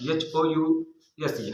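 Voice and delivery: H-O-U-S-E spelled out slowly; letters given one by one, unhurried; slow spelling. Shown as a demonstration. Teacher spelling HOUSE again for the class, slowly. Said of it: "spelling house for pupils".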